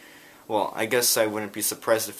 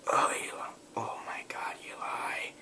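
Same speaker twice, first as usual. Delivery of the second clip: breathless whispered groan of despair; exasperated whisper; 8 dB quieter than usual. Retold or imitated. Uncle fed up with Eli's Jersey Shore talk, whispering. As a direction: whisper it like you've given up.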